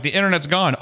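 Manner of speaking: high-pitched retold exasperation; frustrated complaint. Customer telling the worker about his outage, frustrated. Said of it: "So I'm frustrated".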